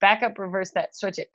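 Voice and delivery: ordinary talk, nothing marked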